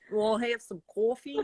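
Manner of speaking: mock a New Yorker ordering "coffee"; bad New York impression. Imitating her New York friend's accent for coffee. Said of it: "my really bad impersonation of her".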